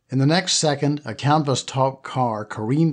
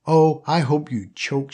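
snarling; 3 semitones higher than usual. Acted. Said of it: "she snarled".

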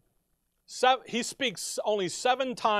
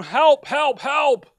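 loud, higher calls for help; a loud, raised plea for help; desperate cries; 5 semitones higher, 7 dB louder, slower than usual. Acted. Voicing Christ calling for help.

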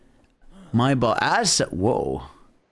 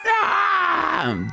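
startled shout; 14 semitones higher than usual. Real, not acted. Reacts to cutscene crash.

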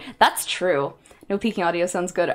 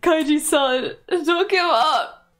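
pleading cheer; 10 semitones higher, 3 dB louder, slower than usual. Retold or imitated. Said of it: "who's like".

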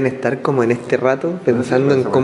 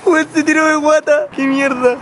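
laughing in disbelief; 13 semitones higher than usual. Real, not acted. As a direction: burst out laughing at a friend. Friend slid down fire chute. (riéndose a carcajadas)

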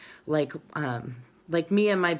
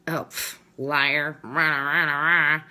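scoff, then jeer in nonsense syllables; imitated catty put-down; mocking naysayer; 2 semitones lower, slower than usual. Voicing women who put others down.

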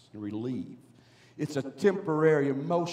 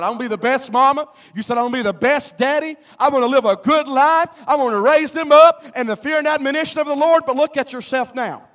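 loud, raised preacher's cry; fired-up preaching; 7 semitones higher, 8 dB louder than usual. Real, not acted. Preacher throws parents' old vows back at them, fired-up preaching. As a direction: build the recited vows into a loud, high exhortation.

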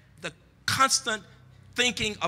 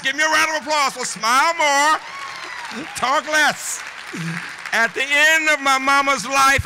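raised, high-pitched oratory; rousing call; 6 semitones higher, 5 dB louder, slower than usual. Real, not acted. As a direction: call out over the applauding crowd. Calling for applause over the crowd.

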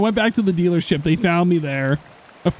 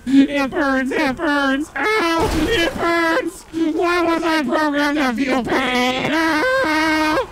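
pained robot cries; 9 semitones higher, slower than usual. Pretend. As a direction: wail like a burning machine. Car AI acts burning pain.

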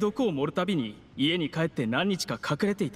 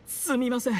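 coughing; 3 semitones higher than usual. Acted. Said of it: "これほど強いお酒は初めてで".

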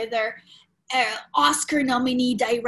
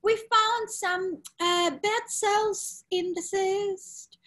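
calm, positive doctor; 9 semitones higher, slower than usual. Retold or imitated. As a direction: break bad news as kindly as possible. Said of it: "super calm and positive about it".

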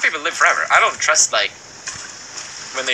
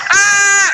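high, loud scream of fright; piercing startled shriek; 6 dB louder than usual. Real, not acted. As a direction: scream at a sudden jump scare. Jump scare in game.

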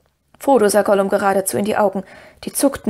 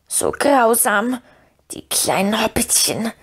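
whining Gollum; slower than usual. Acted. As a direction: beg like a cringing creature, half whispering. Gollum voice held.

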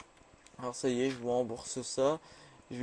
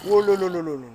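louder, higher panicky oh là là; loud alarmed oh là là; 5 semitones higher, 12 dB louder than usual. Real, not acted. Alarmed cry as the spider nears. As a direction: blurt it out in alarm.

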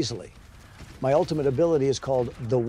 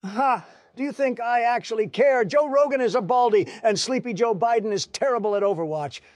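much higher, louder sneering to panic; jeer, then panic as Tyrone arrives; mocking then alarmed; 8 semitones higher, 4 dB louder than usual.

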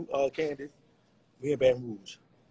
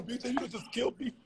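ordinary delivery

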